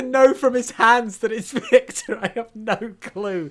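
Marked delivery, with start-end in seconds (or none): none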